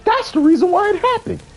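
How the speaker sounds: imitating Fred losing an argument, high put-on voice; Fred's excuse voice. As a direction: squeak out a smug excuse. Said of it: "he was like".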